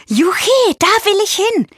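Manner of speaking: high, jubilant outburst; gleeful high-pitched whoop; excited cheer. A narrator's reading. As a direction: cheer with delight. Giraffe cheers about going swimming.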